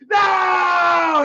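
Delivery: long, high wailing shout; imitated scream. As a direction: cry out "no" in imitation of a stunned dad. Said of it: "then he screamed it, dude, just like that".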